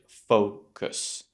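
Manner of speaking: Focus, slow with a long rounded O. Shown as a demonstration. Teacher modelling the word for students, rounded O. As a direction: stretch Focus into two syllables, lips rounded. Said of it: "Keep your mouth rounded".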